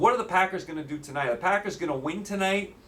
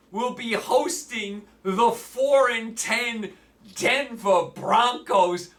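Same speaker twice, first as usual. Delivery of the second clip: mock excitement; 5 semitones higher, slower than usual. Sarcastic hype for bad game.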